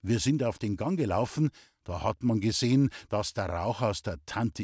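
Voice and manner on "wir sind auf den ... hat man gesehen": usual speaking voice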